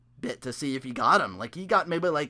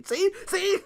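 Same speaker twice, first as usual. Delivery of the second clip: smug laughing; 13 semitones higher than usual. Pretend. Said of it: "retweet it and say".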